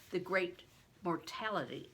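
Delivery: usual speaking voice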